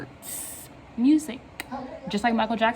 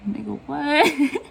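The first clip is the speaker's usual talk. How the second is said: slow, loud, drawn-out disbelief; louder slow-motion what; incredulous; 5 semitones higher, 5 dB louder, much slower than usual. Retold or imitated. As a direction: stretch it out in total disbelief. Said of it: "I was like".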